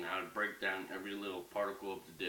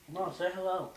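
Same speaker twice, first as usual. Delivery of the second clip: coaxing the dog; 11 semitones higher, 7 dB louder than usual. Real, not acted. Coaxing dog to greet viewers.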